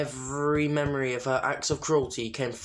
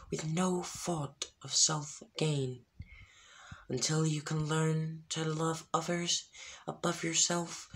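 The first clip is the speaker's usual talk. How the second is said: distant spirit sentence; 4 semitones higher, 5 dB quieter than usual. A narrator's reading. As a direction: pronounce the sentence from far away.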